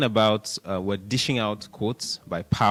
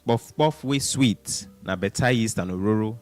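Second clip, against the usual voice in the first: Pidgin English saying. Retold or imitated. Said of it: "this quote says".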